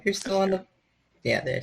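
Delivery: ordinary talk, nothing marked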